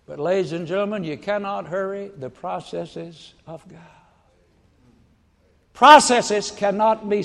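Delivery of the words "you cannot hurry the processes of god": normal talking voice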